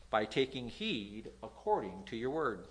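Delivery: normal talking voice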